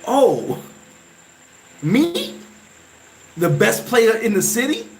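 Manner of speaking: high, incredulous questions, a retold shock; stunned disbelief. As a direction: voice jumping up in disbelief at being named. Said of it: "I was like".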